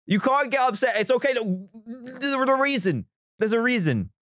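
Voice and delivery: high, pleading calm-down; talking fans down from upset; frantic reassurance. Real, not acted. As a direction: soothe them while half-panicking. Consoling upset chat, pitched high.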